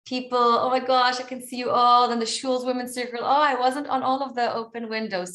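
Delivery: high, bubbly burst of recognition; excited. Real, not acted. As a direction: gush with real excitement at spotting friends. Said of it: "I'm gonna get excited".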